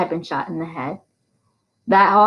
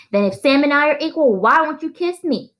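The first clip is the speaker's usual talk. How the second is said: mocking taunt; 8 semitones higher, 3 dB louder than usual. A narrator's reading. Sheriff mocking teacher.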